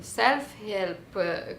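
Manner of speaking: ordinary delivery, nothing marked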